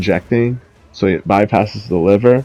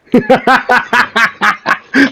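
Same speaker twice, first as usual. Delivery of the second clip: high laugh; 13 semitones higher than usual. Real, not acted. Laughing at Hassan's flexing, high laugh. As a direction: burst of high-pitched laughter.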